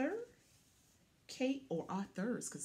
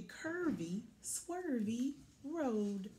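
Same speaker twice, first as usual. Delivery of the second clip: slow, drawn out swervy road; 3 semitones higher, 4 dB quieter, slower than usual. Acted. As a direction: stretch it like a swerve. Curvy road described.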